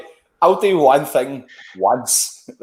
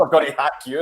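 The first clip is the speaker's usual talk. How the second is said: ordinary delivery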